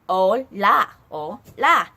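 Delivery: "Hola" with a silent h, oh-LAH; Hola pronounced. Shown as a demonstration. Teacher models the greeting for students, "Hola" said clearly. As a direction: say "Hola" clearly for the class to copy. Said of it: "hi in Spanish is like".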